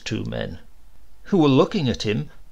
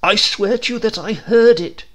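high, loud earnest protest; the postman swears it, higher and louder; insistent swearing; 9 semitones higher, 7 dB louder than usual. A narrator's reading. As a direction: plead to be believed.

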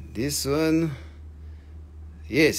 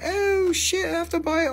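much higher, squealing mock buyer; mock excited buyer; 13 semitones higher than usual. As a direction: gush like a shopper who can't resist. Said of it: "everybody will be like".

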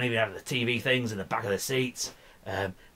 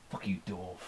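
normal talking voice

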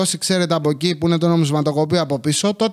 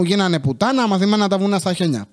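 usual speaking voice

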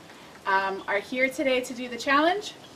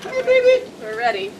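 excited squeal; 13 semitones higher, 7 dB louder than usual. Real, not acted. Bracing for the ice bucket.

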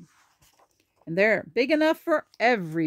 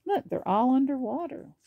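usual speaking voice

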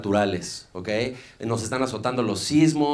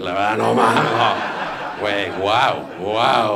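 mock thrilled; 3 semitones lower, 4 dB louder, much slower than usual. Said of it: "Yo si fuera Yunes".

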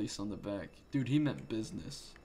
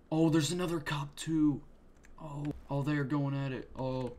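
excited; 4 semitones higher, 5 dB louder than usual. Real, not acted. Sudden cop fight.

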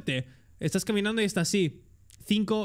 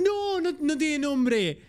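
character voice; 6 semitones higher, much slower than usual. Acted. Voicing a game character answering.

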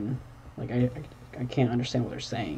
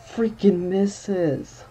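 exasperated wail; 7 semitones higher, 5 dB louder, much slower than usual. Real, not acted. Pokemon missing attack.

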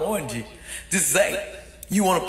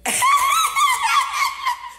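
very high, loud shrieking laugh; 5 dB louder than usual. Real, not acted. Laughing at the demons that failed. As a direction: burst into a shrill triumphant cackle. Big laugh after taunt.

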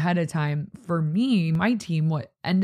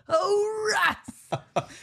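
much higher, cartoon villain crying Oh, rats; Oh, rats in a squeaky foiled-villain whine. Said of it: "The villain would be".

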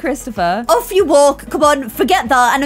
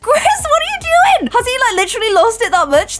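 high, disbelieving squeal; high indignant shock at the husband; incredulous outburst; 12 semitones higher, faster than usual. Real, not acted. Husband acts as receptionist.

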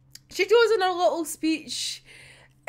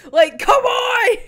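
high, loud, drawn-out plea; exasperated shout; 7 semitones higher, 8 dB louder, slower than usual. Real, not acted. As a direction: wail it slowly in frustration. Pleading for one kid to survive.

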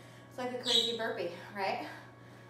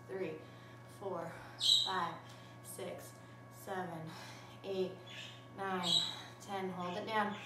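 soft, calm stretching cues; calm cool-down voice; 5 semitones lower, 9 dB quieter than usual. Real, not acted. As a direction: guide the cool-down gently.

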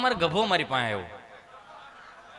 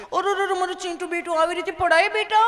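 high, louder cooing mock mother with a laugh; hammed-up fussing mother, higher and louder; doting mother; 12 semitones higher, 6 dB louder than usual. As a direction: coo over the fallen child like a fussing mom. Mother fusses over fallen child.

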